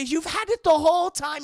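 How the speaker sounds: put-on loud, high outraged German accent. Elsa learning Indy had the diary, outraged German accent. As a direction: accuse him in a mock German accent. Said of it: "You have a very German accent".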